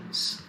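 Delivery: "s" as a voiceless hiss; hissed s sound. Shown as a demonstration. Teacher shows final or middle S, a plain hissed "s". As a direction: hiss "s" by itself. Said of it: "we pronounce it as s".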